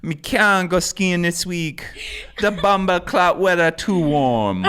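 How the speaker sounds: slow, high, loud Jamaican patois; Jamaican accent. Pretend. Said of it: "I say that I do that accent".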